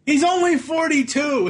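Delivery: shocked outburst. Real, not acted. Realizing the child actor is only forty-two, shocked. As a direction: blurt out the disbelief. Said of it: "shocked at actor's age".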